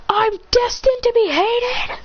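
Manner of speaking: very high, slow shocked question; shocked disbelief. Acted. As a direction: gasp out the realization. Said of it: "He goes".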